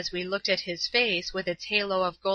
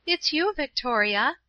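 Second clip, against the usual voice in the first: higher-pitched, pleased greeting; glad cry; 7 semitones higher than usual. A narrator's reading. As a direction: cry out the name in happy recognition. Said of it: "Paula cried".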